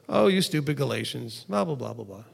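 soft, low, throwaway mumble; mild offhand. Retold or imitated. Paul's rebuke said softly, as he did not say it. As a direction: toss it off without any force. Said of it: "He didn't just go".

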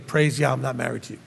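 low, soft muttered aside; a congregant quietly thanking God he's not the rabbi's spouse. Retold or imitated. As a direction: mutter it under your breath, relieved. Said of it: "some of you are thinking".

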